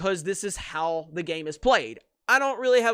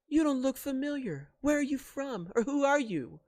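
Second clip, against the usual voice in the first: higher, softer voice of a curious stranger; acted stranger; 5 semitones higher, 5 dB quieter than usual. Stranger on Tatooine asks Rey who she is, acted stranger. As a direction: play a stranger questioning Rey, pitched up. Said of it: "someone comes up to Rey and says".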